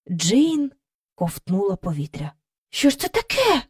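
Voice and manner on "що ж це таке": high-pitched astonished question; startled exclamation. A narrator's reading. Jane seeing a strange kite descend, startled exclamation. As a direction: exclaim in bewilderment, voice jumping up. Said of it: "вигукнула вона".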